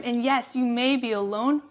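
usual speaking voice

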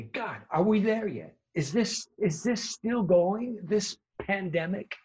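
high, slow, drawn-out plea; slow, high mock lament; weary whine. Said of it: "I say".

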